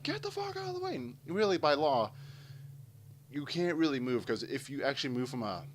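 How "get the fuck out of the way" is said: very high mock yell from behind; impatient honking drivers. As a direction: imitate annoyed motorists in a high strained voice.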